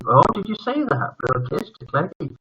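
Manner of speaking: usual speaking voice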